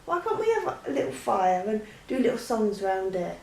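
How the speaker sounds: ordinary talk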